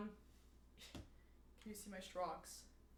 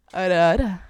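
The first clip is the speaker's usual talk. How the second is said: teasing anime lady; 5 semitones lower, 15 dB louder, much faster than usual. Pretend. Anime catchphrase voice.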